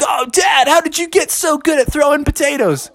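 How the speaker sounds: put-on high, whiny-wondering kids' voice; amazed kids. A narrator's reading. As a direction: ask Dad in high-pitched amazement. Everybody marvels at Dad.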